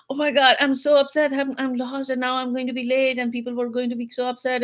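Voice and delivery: replaying her own frantic phone rant to her husband. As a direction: rush it out like you're lost and late. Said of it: "ranting and ranting".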